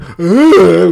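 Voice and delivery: mock dazed uh, loud; imitating a clueless guy at the door; zombie grunt. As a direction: blank open-mouthed grunt. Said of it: "He comes in".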